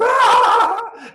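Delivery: very high, loud mock crowd shriek; crowd gasp. Onlookers reacting to the booger joke, high shriek. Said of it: "everybody's like".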